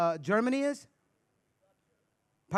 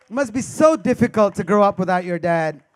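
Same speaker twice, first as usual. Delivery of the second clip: hammed-up pity; 8 dB louder than usual. Repeated pity louder.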